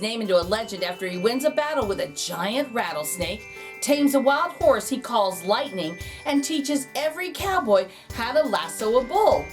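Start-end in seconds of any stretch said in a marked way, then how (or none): none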